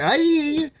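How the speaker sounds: sarcastic, dismissive yes; sarcastic reply. Retold or imitated. Answering Elizabeth with sarcastic mock agreement. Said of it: "I'm like".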